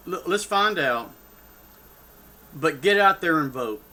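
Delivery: ordinary talk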